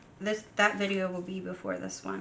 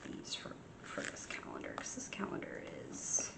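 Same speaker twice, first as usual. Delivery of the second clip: whispering; 12 dB quieter than usual. Real, not acted. Quiet aside while crafting.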